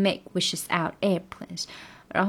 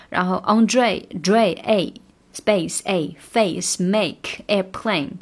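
Andre, space, face, make, airplane each hit loud on the A vowel; slow, punching every A sound in Andre Dre space face make airplane; stressed A sounds; 5 dB louder, much slower than usual. Shown as a demonstration. Teacher stresses each rhyming A sound. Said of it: "这些A的发音点都要重读".

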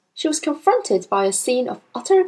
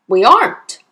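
loud, final answer; forceful, brusque dad; curt father; 8 dB louder than usual. Acted. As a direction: shut the child down bluntly. Father curtly refusing daughter.

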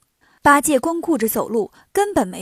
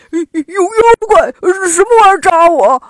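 high, loud, stammering cry of alarm; pained shouting; 6 semitones higher, 11 dB louder than usual. A narrator's reading. Pigsie pecked awake and fearing a monster, shouting in pain. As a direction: yelp out as if jabbed. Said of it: "八戒疼得喊起来".

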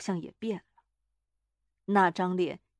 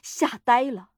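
ordinary delivery

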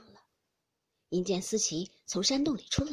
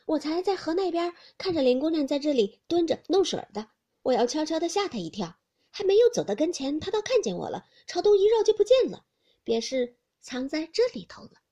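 put-on louder, higher lively young lady; Baochai feigning a chase; 6 semitones higher, 8 dB louder than usual. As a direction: sell the made-up story brightly. Baochai's pretend story.

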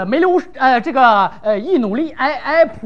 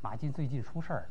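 much softer, lower secret-telling; conspiratorial hush; 19 dB quieter, faster than usual. Acted. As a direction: lean in and share gossip under your breath. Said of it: "大声说".

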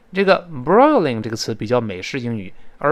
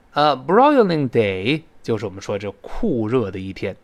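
ordinary talk

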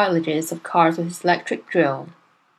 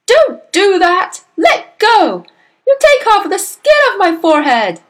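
high, loud pained protest; screaming; 11 semitones higher, 8 dB louder than usual. A narrator's reading. Husband to wife yanking his glued hat, screaming. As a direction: yell in alarm to make it stop. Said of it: "he screamed".

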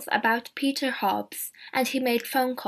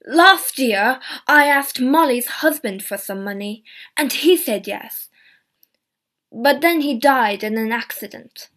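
raised, louder angry protest; 7 dB louder than usual. Acted. Albert answers the inspector's accusation in a loud angry voice. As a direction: defend himself hotly against the inspector. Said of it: "Albert was angry".

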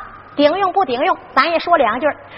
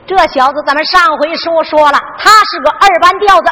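ordinary delivery

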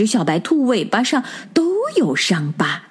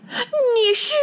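much higher, shocked squeal; startled shout. A narrator's reading. Rabbit manager meeting its double, a high shocked cry. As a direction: gasp out the question in alarm. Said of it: "大叫".

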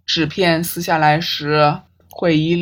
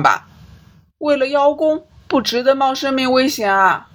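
higher, fast, defensive pleading; agitated protest; 5 semitones higher than usual. Acted. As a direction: rush the denial, voice climbing.

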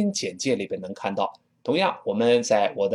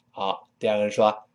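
ordinary delivery

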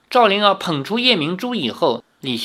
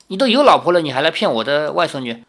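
ordinary delivery, nothing marked

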